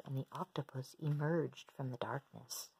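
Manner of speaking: ordinary delivery, nothing marked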